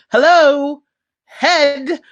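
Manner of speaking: exasperated high call; loud high exclamation. Retold or imitated. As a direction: yell it like it's obvious. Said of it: "And he's like".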